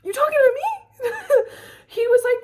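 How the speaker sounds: high, loud, laughing question; laughing disbelief. Real, not acted. Laughing at his gall.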